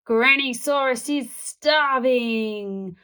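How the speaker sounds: slow, put-on monster voice from the dark; spooky dinosaur voice. A narrator's reading. Granny as a dinosaur teasing the boy in the dark. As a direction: draw it out like a hungry monster. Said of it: "came a voice in the dark".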